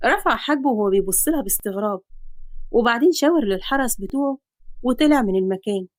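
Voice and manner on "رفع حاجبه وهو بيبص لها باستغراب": plain reading voice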